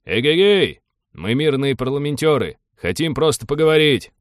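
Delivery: loud, high hail across a distance; calling out. A narrator's reading. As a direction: call out to a hidden guard to parley. Envoy calls out to hostile guard.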